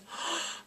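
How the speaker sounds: sharp high gasp; quick intake of mock horror; stunned, breath-only reaction; shocked gasp. Shocked at retold news.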